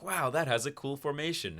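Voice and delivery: high, loud, gleeful admiration; hammed-up excited awe; mock wonder. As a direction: marvel obliviously at a cool discovery. Humans admiring the ant cast.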